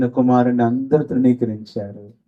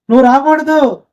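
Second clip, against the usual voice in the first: higher, louder dismissal; turning away; 14 semitones higher, 6 dB louder than usual. Retold or imitated. Church elders telling the poor man to stay out, sharp. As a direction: shut him out sharply.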